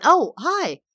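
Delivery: high, loud startled greeting, imitated; jolted awake, blurt a surprised hi. Girl waves at her in cafeteria, startled hi.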